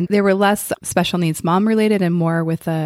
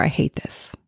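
whispered complaint; 5 dB quieter than usual. Retold or imitated. Example overwhelmed parent thought.

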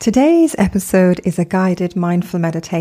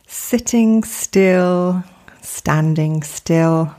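guided meditation. Acted. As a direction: gently guide them into stillness, unhurried, phrase by phrase.

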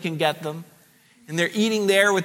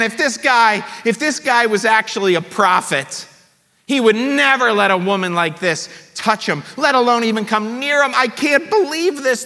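disgusted Simon; 5 semitones higher than usual. Acted. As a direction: recoil in disgust as the Pharisee. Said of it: "Simon's thinking".

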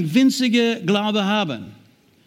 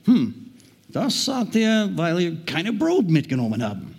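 pondering aloud; 2 semitones lower than usual. Retold or imitated. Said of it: "überlegten bei sich selbst und sagten". (gespielt grübelnd, langsam)

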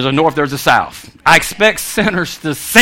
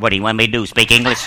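low, softer deadpan dog voice; talking dog; 10 semitones lower, 5 dB quieter, faster than usual. Pretend. Dog turning round to its owner, low deadpan retort. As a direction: answer back low, as a put-upon dog. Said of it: "if the dog turned around".

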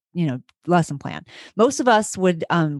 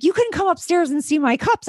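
high-pitched panicked denial, acted out; freaking out; 9 semitones higher than usual. Teacher denying beer to the principal, freaking out. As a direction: protest your innocence in a retold panic. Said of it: "And I'm freaking out".

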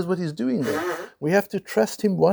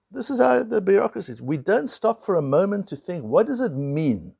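usual speaking voice

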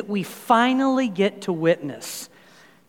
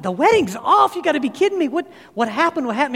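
higher, alarmed disbelief; shocked disbelief; 7 semitones higher, faster than usual. Retold or imitated. Father hearing his daughter's wedding is off, shocked. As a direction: gasp it out like the news just hit you.

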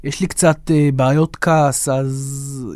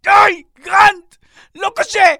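exasperated shout; 3 dB louder than usual. Acted. Guest shouting his own name at the confused host.

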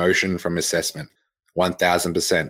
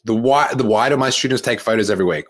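ordinary talk, nothing marked

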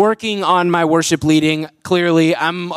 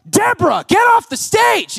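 mock exasperated shout; 12 semitones higher than usual. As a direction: shoo an overeager parent off stage. Mock scolding of intrusive parent.

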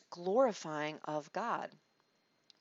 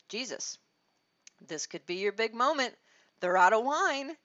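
louder, rising eager urging; eager Mary; 4 semitones higher, 8 dB louder than usual. Retold or imitated. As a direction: push him toward his big moment. Mary voiced urging Jesus.

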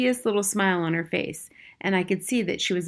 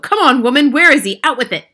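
loud, high, bullying demand; impatient shout; 6 semitones higher, 11 dB louder than usual. A narrator's reading. Father demanding his son from grandmother, angry shout. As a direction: demand it at full volume.

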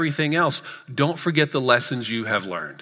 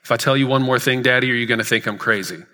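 put-on faster, tumbling out like an anxious six-year-old; rushed child quote; faster than usual. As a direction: hurry the words as the nervous daughter.

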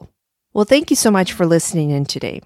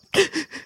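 sobbing gasp; 10 semitones higher, 3 dB quieter than usual. Acted. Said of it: "Butterfly sobbed".

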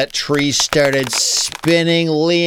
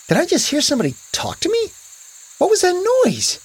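put-on high, fast, puzzled boy voice; startled wondering; 13 semitones higher, much faster than usual. A narrator's reading. Boy hears a voice in the woods, startled and high. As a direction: blurt it high and quick, startled.

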